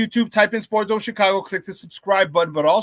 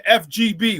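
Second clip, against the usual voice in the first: normal talking voice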